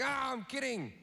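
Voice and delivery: silly fake-innocent retreat; mock backpedal. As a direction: laugh it off like a crazy guy. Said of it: "cover your steps very quickly by going".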